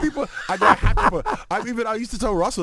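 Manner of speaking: laughing. Real, not acted. Comic cracking up at his own story, laughing. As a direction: laugh while talking.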